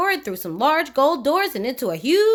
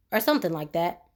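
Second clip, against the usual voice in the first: ordinary delivery, nothing marked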